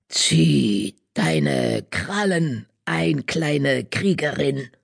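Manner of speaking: slow, raspy warning growl; rough growl. A narrator's reading. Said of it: "knurrte sie mit rauer Stimme".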